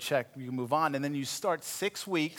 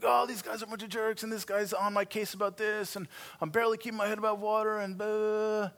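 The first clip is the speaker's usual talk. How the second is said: higher, whiny complaining rant; venting; 7 semitones higher than usual. Retold or imitated. Son venting on the phone to his dad, whiny and higher. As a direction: vent to your dad like a frustrated kid.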